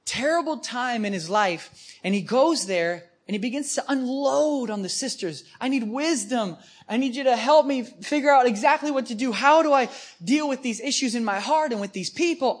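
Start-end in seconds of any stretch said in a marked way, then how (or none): 5.6-12.6 pleading Michael. Retold or imitated. Michael unloads on the sisters.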